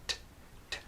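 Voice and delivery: bare t let go with air; short popped t; released T sound. Shown as a demonstration. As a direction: release the t on its own. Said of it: "that is releasing the T".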